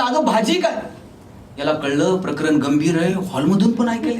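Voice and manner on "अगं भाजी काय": lightly acted call to someone in another room. Husband calls to wife from the hall, mildly acted calling out. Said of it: "हॉलमध्ये जाऊन आवाज दिला".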